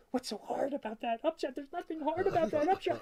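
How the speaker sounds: high, teasing disbelief; father scoffing at his son's upshot, mock incredulous. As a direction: needle him about an easy shot. Mocking son's trouble with shot.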